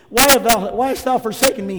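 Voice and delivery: loud, sung-out cry of forsakenness; anguished cry. Retold or imitated. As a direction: wail it to heaven in anguish. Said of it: "He cried out in the flesh".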